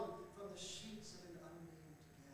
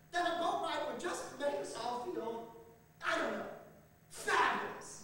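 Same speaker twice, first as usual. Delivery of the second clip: louder, giddy and gushing; excited; 5 semitones higher, 9 dB louder than usual. Acted. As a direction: gush about it happily. Dog gushing about boat.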